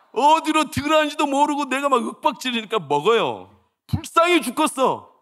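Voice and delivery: high, loud fired-up preaching; preaching at full force. Acted. Pastor to his congregation about weary children, preaching at full force. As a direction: pour it out over the pews, loud and high.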